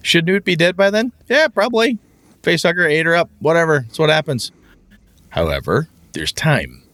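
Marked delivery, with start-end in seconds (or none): 0.0-4.5 mock callous. Callous GM brushing off death.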